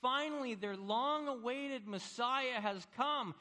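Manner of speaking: high, loud crowd rejoicing; jubilant. Acted. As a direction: proclaim it as an overjoyed crowd. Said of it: "the people are jubilant".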